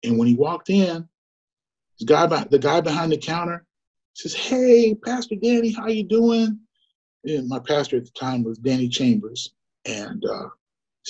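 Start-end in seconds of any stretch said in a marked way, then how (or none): 4.3-6.6 put-on high, bright-pitched hello to an old friend; excited greeting. Shop owner greeting the pastor, high and excited. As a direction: greet him like a long-lost buddy.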